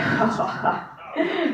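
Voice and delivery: high-pitched imitation of a boss's big laugh; imitated robust laugh. Wrestler imitating the boss reacting to her joke, robust laugh. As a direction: mimic his sudden hearty laugh. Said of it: "he let out a robust laugh".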